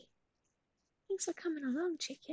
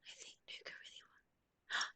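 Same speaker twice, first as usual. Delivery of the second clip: hushed unvoiced whisper; 10 dB quieter than usual. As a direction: whisper it in suspense. Whispers awaiting chat answer.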